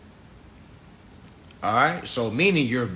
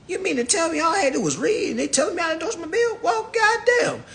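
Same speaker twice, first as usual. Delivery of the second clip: incredulous outburst. Real, not acted. Disbelief at the simple answer.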